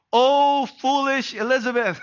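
hammed-up parental exasperation; exasperated parent. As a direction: playfully throw up your hands at your daughter. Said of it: "I wanna say".

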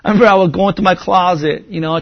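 ordinary talk

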